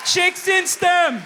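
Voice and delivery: high, drawn-out shout; loud mock rallying cry; rallying cheer. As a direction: yell it slowly like a crowd chant. Whooping cheer for engineers.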